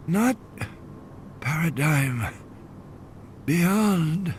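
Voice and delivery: faint, drifting fever voice; half-lost words from a man sinking into delirium; delirious murmur. A narrator's reading. Feverish Malcolm telling Harding what lies beyond, delirious murmur. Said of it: "slipping into a terminal delirium".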